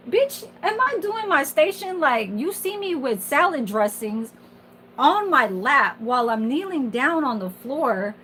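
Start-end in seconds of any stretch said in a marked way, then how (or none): 0.1-4.3 high-pitched indignant comeback; indignant retort. Acted. Dietary aide answering a rude coworker in a retelling, indignant. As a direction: throw it back at the coworker, affronted.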